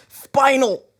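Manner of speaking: high, lisping Tyson voice; Mike Tyson impression. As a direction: do a squeaky Tyson lisp.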